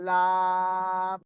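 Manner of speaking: লাম held very slow and long; লাম sustained slowly as a demonstration; model sound. Shown as a demonstration. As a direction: stretch out লাম for them to copy. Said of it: "উচ্চারণ করে লাম".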